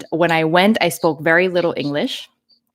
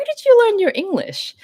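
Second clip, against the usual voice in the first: quoting the teacher; 3 dB louder than usual. Retold or imitated. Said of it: "she asked me".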